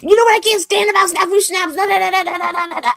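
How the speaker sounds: high, loud mock hater voice; sneering imitation of angry commenters; mocking internet haters. [name] mocking angry internet commenters, high and sneering. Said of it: "They're like".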